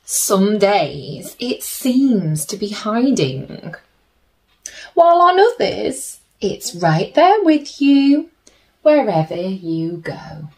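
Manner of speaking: gentle storybook reading with slow pauses; soothing storybook narration for little listeners; reading to children. A narrator's reading. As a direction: read it like a bedtime picture book. Reads picture book to children.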